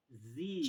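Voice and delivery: जी said slowly with a long vowel; slow stretched जी; stretched letter. Shown as a demonstration. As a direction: draw out जी. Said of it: "अ sound है".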